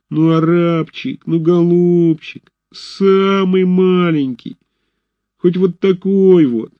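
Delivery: high, slow sugary pleading; begging whine. A narrator's reading. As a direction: whine like a beggar child. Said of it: "умильным тоном".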